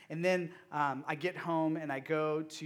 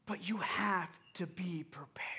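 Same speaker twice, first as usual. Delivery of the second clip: a breathy hushed aside; whispered warning; 3 semitones higher than usual. Acted. Pastor leaning in to warn his group, whispered. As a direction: whisper it like a secret.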